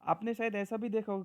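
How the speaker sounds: usual speaking voice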